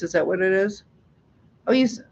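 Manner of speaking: ordinary talk, nothing marked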